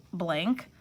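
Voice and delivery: usual speaking voice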